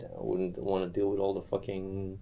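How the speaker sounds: normal talking voice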